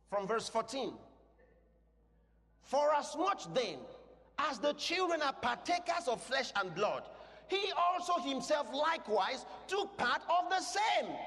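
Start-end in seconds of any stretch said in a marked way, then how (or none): 2.6-11.1 high, measured, ringing proclamation; forceful scripture reading. Real, not acted. Preacher reading Hebrews to the congregation, forceful proclamation. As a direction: declaim the verse, building to the last word.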